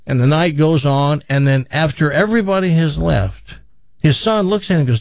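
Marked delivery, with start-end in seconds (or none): none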